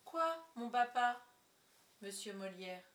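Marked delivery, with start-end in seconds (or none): 0.0-1.2 higher, slow innocent question; theatrical feigned innocence; child's stage reply. A narrator's reading. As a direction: answer papa in a projected little-girl voice. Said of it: "et je dis".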